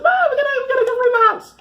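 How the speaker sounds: high, fast squawk; hammed-up frantic protest with a laugh; mock insisting. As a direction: rattle it off in a silly high voice. Mock quote of the pastor's party.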